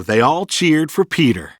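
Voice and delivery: ordinary delivery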